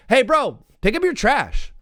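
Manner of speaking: calling out litterers. Retold or imitated. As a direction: yell across the lot at the litterers. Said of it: "If I was like".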